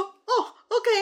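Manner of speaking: high, bright "哦，OK"; sudden happy surprise; surprised ok. Retold or imitated. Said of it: "然后我就说". (惊喜地高声说)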